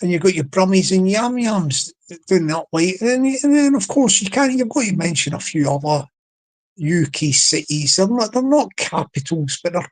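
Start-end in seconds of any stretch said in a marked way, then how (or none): none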